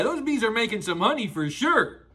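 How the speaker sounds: plain reading voice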